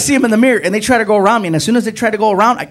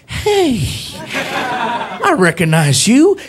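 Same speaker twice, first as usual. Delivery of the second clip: high, slow, drawn-out recognition; police officer voice; 7 semitones higher, much slower than usual. Retold or imitated. Officer recognizing the comedian, high slow drawl. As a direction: imitate a slowly delighted cop. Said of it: "He's like".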